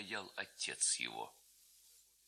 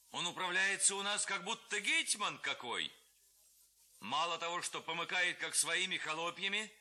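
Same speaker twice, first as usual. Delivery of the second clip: higher, aggrieved complaint; indignant lad; 7 semitones higher than usual. A narrator's reading. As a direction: rile them up. Lads complain of the head. (парубок возмущается головой)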